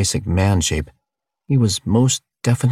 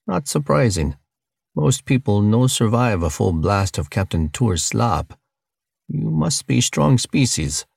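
higher-pitched alien with broken English; friendly foreign-sounding creature voice, pitched up; alien cellmate; 5 semitones higher than usual. Acted. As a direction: play an alien cellmate, higher than the narrator.

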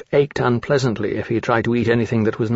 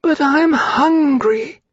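put-on very high, slow baby-dragon whine; wheezy hatchling; much slower than usual. A narrator's reading. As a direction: plead slowly in a tiny high creature voice. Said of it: "pulled in his wheezing breath and said".

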